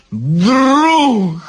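put-on high, drawn-out drunken roar. A narrator's reading. Drunk guard moved by the devil, maudlin roar. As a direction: bellow it high and stretched, stage volume. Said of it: "заревел стражник".

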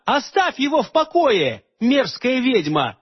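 high furious yell, slowing on the insult; angry shout. A narrator's reading. Aleonora yelling at Morgana to leave Holger. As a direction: hurl it at the witch. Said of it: "закричала она".

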